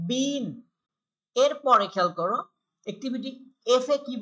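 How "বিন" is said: ordinary delivery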